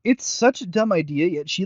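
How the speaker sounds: normal talking voice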